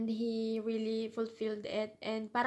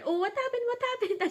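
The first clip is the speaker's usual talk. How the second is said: much higher, hammed-up fake concern; mock surprise; 12 semitones higher, 4 dB louder than usual. Imitating the cousin pretending he never saw her fall, mock surprise. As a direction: play innocent, as if you just noticed.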